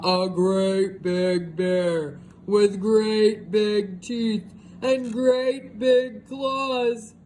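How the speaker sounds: very slow, looming narration; slow, dramatic build. A narrator's reading. Describing the great big bear, slow and looming. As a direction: draw it out to build fear.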